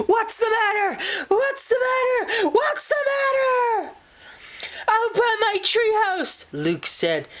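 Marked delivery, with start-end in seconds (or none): 0.1-3.9 very high panicked shout; jolted awake in alarm; mother leaping out of bed in panic; startled mother. Acted. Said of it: "His mother jumped out of bed and said". 4.9-6.5 put-on much higher, eager child voice; boy bursting to tell his mom; excited child. Child excitedly reports moose.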